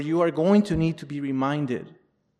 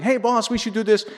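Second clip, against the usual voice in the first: higher, lightly acted worker pitching up; a hint of a keen underling, raised pitch; eager employee; 7 semitones higher, 4 dB louder than usual. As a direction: pitch an idea to your boss. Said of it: "worked for a boss".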